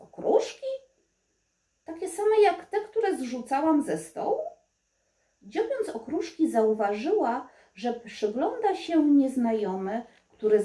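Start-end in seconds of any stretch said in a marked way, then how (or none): 0.0-4.4 surprised realisation. Acted. Surprised to find crumbs.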